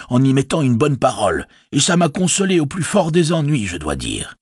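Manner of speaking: a worried village innkeeper speaking up; innkeeper Butterbur. Acted.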